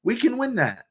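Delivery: high, breezy fake confidence; mock optimistic. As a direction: chirp it naively like a hopeful fan. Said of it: "people may be like".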